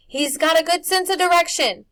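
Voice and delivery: high, loud calling voice. A narrator's reading. Old woman calling to the boys in the mist, loud.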